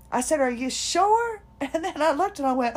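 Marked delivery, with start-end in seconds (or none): 0.4-1.4 high, slow "are you sure"; can't believe how little time passed; disbelief. Retold or imitated. Told it's been thirteen minutes, stunned disbelief.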